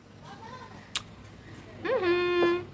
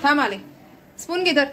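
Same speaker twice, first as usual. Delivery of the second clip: calling out; 6 semitones higher, 5 dB louder than usual. Real, not acted. Calling out to husband.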